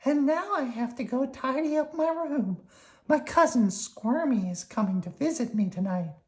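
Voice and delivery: high squeaky worm; high, cheerful little worm. Acted. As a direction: pitch it up like a tiny worm. Slimy voiced.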